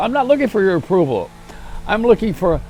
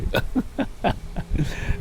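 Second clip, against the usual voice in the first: breathy laugh; 3 semitones higher, 8 dB quieter than usual. Real, not acted. Laughs at own joke.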